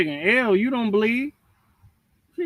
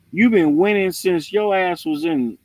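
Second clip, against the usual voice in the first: ordinary talk, nothing marked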